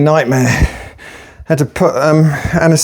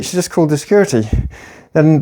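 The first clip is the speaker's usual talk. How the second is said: usual speaking voice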